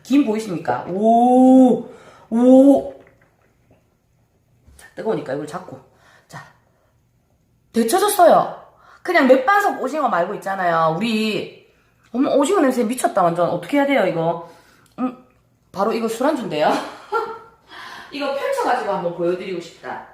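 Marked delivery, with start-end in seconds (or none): 0.9-2.9 loud amazed oh; excited loud oh. Real, not acted. Sieht den Dampf. 16.8-17.4 quick unvoiced high giggle; a short breathy laugh. Real, not acted. Lacht über Witz.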